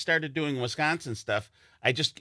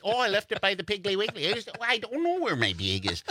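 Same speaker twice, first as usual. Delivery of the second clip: high, hammed-up Wisconsin accent; 9 semitones higher than usual. Wisconsinite hunting for a lost bag, high Wisconsin accent. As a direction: pitch it up, "bag" rhyming with vague. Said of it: "We say bag".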